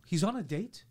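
normal talking voice

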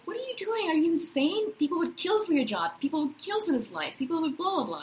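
high, incredulous imitation of everyone; lightly voiced shocked friends; mock disbelief. Everyone scolding her for quitting, mock disbelief. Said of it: "everyone was like".

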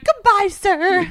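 high, loud, slow drawn-out farewell; mock huffy goodbye. French woman calling down the street, slow high goodbye. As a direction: stretch the goodbye out in a sulky sing-song.

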